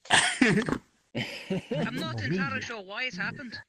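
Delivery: slow put-on Scottish accent; mock-Scottish puzzled reporter. Reporter answering the anchor, in mock Scottish. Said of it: "his accent has gone Scottish".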